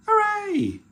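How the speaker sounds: very high, loud squealed cheer; piercing happy whoop. A narrator's reading. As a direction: cheer like an overjoyed little girl. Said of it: "cheered Peppa".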